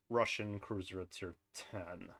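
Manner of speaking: ordinary talk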